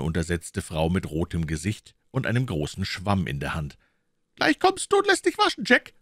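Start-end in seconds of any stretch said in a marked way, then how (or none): 4.4-5.9 high-pitched scolding mother; scolding shout. A narrator's reading. Mother orders her runaway boy to be washed, scolding shout. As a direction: call out sharply in a much higher woman's voice. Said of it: "schrie sie".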